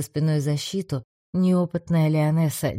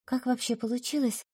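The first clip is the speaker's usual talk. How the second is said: put-on young woman voice; 9 semitones higher, 6 dB quieter than usual. A narrator's reading. Голос Адели.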